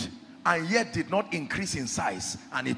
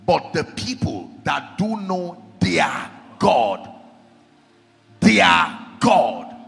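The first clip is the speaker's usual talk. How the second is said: loud, raised declamatory preacher voice; full-force preaching; 3 semitones higher, 9 dB louder than usual. Real, not acted. Preacher proclaiming God to the congregation, full-force preaching. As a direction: preach it loud, stretching the key words.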